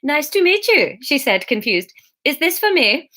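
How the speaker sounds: high, sing-song Swedish chef; lightly done Swedish chef voice; best attempt at Swedish. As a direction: commit to the Swedish lilt. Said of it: "my best attempt at Swedish".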